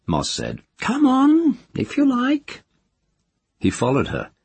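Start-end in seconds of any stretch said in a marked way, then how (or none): 0.8-2.6 put-on high, cracked old crone gossiping; nosy old village witch. A narrator's reading. Moss speaks.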